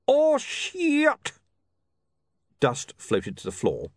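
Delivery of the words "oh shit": put-on very high, drawn-out accented wail; alarmed cry. Iconographer caught by his own flash, high cry of alarm. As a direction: cry out as disaster strikes. Otto cries out as flash destroys him.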